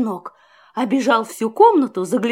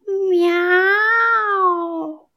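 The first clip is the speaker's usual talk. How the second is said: kitten meow; 4 semitones higher than usual. A narrator's reading. Kitten meows.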